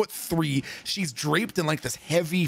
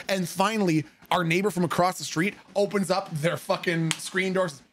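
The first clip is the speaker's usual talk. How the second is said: ordinary delivery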